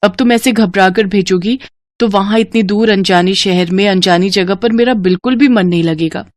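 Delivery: ordinary delivery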